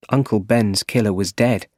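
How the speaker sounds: plain reading voice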